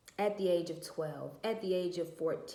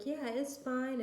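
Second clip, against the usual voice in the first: high, mocking imitation of the other mom; 8 semitones higher, slower than usual. Mother quoting the other mom's brush-off, in a high mocking voice. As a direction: play her breezy brush-off, pitched way up.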